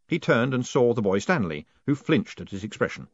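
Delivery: ordinary delivery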